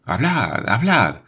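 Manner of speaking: urgent, rising hablad; eager urging. A narrator's reading. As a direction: press him to speak, all together. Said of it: "decían a la vez".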